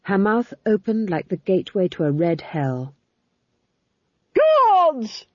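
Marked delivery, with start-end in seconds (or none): 4.3-5.3 high, loud yell for the guards; imperious Duchess yelling for help. A narrator's reading. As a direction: shriek the summons at full pitch. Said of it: "she yelled".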